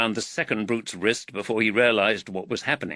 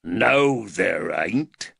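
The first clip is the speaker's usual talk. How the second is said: deep growl; much slower than usual. A narrator's reading. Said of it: "growled a deep voice".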